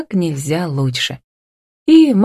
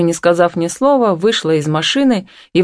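plain reading voice

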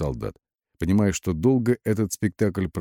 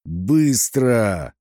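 bellowed urgent order, raised pitch; bellowing roar; 4 semitones higher, 4 dB louder than usual. A narrator's reading. Батон hurries the lieutenant along, roaring. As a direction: roar it to hurry them. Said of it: "раненым носорогом заревел Батон".

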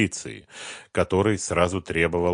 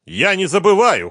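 high, loud shout; 11 dB louder than usual. Acted. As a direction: shout it back. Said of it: "крикнул Диланов".

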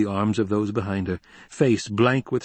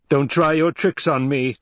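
louder, higher, sharp warning; angry rebuff; 6 semitones higher, 5 dB louder than usual. A narrator's reading. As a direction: refuse to be handled, voice raised. Emperor rebuffs his daughter.

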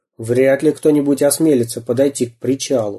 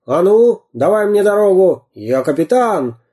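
грубый окрик; 7 semitones higher, 3 dB louder than usual. Acted. Пират расталкивает толпу.

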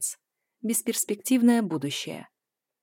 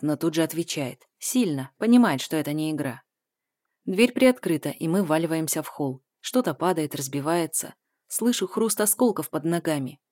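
ordinary delivery, nothing marked

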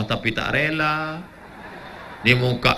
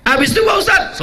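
calling out; 7 dB louder than usual. Acted. Jemaah memanggil ustadz.

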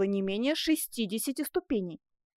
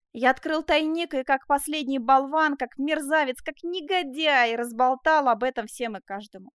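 bitter self-reproach; 3 semitones higher, 5 dB louder than usual. Acted. Man confesses he blabbed a secret, self-reproachful. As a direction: spit out each insult at yourself. Jacques berates himself for betraying secret.

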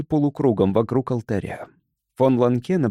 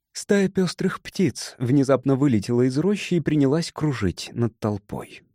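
ordinary delivery

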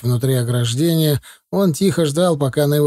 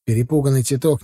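plain reading voice

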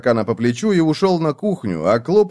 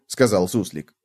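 usual speaking voice

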